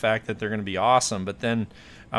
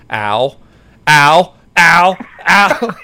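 loud "ow" yelps, rising higher each time; retold yelping, building louder and higher; mock pain yelps; 6 semitones higher, 13 dB louder, much slower than usual. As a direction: imitate escalating yelps of stinging pain. Imitates teammate yelping in pain.

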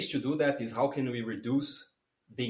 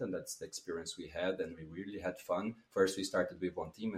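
ordinary talk, nothing marked

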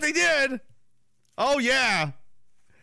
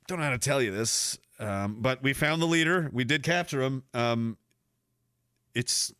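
sheepish official; 8 semitones lower, 8 dB quieter than usual. Retold or imitated. Police reporting the bad news.

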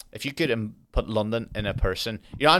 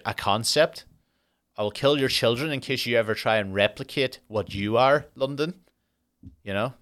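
usual speaking voice

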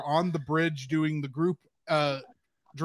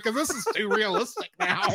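laughing; 6 semitones higher than usual. Real, not acted. Laughing at the holodeck.

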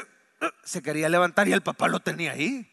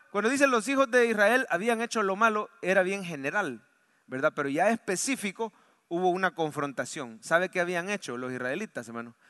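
ordinary talk, nothing marked

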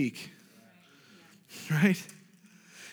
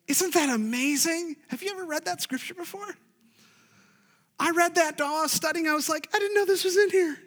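high-pitched, quick astonishment; amazed; 10 semitones higher than usual. Acted. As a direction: squeal it in wonder at a new discovery. Preacher acts out amazement at scripture.

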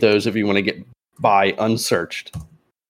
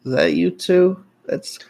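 put-on nervous young officer; 6 semitones higher, slower than usual. Young officer checks names.

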